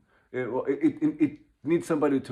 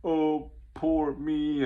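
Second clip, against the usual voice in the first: slow, higher self-pitying whine, lightly hammed; mock victim; 5 semitones higher, much slower than usual. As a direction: play the poor victim, dragging it out. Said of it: "kind of victim mentality, and, like".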